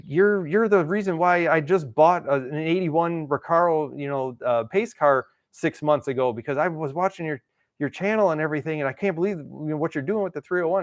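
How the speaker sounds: a lightly voiced eager fan; excited fan. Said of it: "he was super excited".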